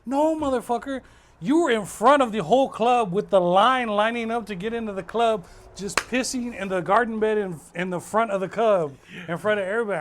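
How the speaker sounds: loud, scolding rant; exasperated friend. Retold or imitated. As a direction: chew him out for peeing in public. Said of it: "he's like".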